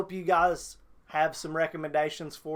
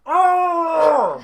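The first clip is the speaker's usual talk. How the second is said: loud high yelp of disbelief; real shout of outrage; outraged cry; 12 semitones higher, 13 dB louder than usual. Real, not acted. Viewer reacting to the fanfic's ending, loud.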